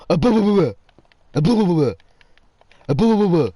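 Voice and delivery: loud, high babble of mashed syllables; mock blubbering gibberish. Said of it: "What do they think we go".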